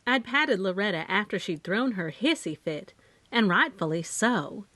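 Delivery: ordinary delivery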